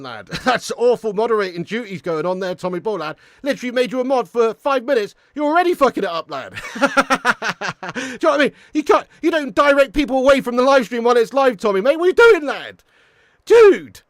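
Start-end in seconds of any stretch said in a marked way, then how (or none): 3.4-12.8 higher and louder, half-laughing telling-off; laughing exasperated scolding. Real, not acted. Streamer telling off his new mod, loud amused exasperation. 13.4-14.0 much higher and louder yelp of disbelief; exasperated shout. Real, not acted. Streamer yelling at his blundering mod, loud disbelieving shout.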